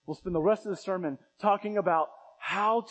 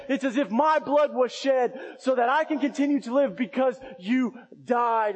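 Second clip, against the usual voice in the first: raised, higher preaching voice; preaching at full force; 7 semitones higher, 5 dB louder than usual. Acted. Preacher driving home the substitute, raised voice. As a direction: preach it out, climbing. Preacher voicing worshiper to animal.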